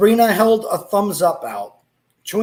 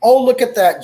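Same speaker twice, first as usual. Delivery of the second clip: louder, smiling praise; mother praising her son's candy; 4 semitones higher, 5 dB louder than usual. A narrator's reading. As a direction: sound impressed for him. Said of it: "Jane said with a smile".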